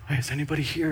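soft, furtive question; a sneaky half-whisper into an empty room; hushed check. Retold or imitated. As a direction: quietly check that nobody is around. Sneaking off to sin unseen.

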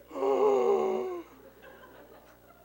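high, loud laughing gasp; dad winded on the couch. Retold or imitated. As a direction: gasp like the wind was knocked out. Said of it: "He's like".

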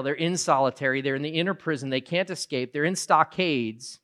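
usual speaking voice

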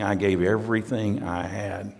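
soft, low, fond and devoted; a widower's tender vow; quiet devotion. Retold or imitated. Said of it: "He's saying".

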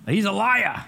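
put-on joking Maine accent, teasing the congregation. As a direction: play the apostle John with a bit of a Maine accent. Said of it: "he's got a little Maine accent going on".

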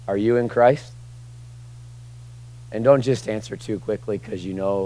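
ordinary talk

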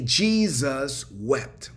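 very slow, each word drawn out; slow, weighty pronouncement. Real, not acted. Preacher quoting the Bible's climax to the congregation, slow and weighty. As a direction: stretch it out and let it land.

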